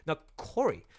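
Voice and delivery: ordinary talk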